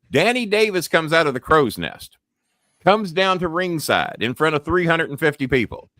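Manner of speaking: ordinary delivery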